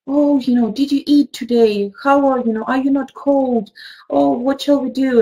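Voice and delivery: fussy mother. Retold or imitated. Teacher imitating a fussy mother fretting over her son. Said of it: "women are fussy about their sons".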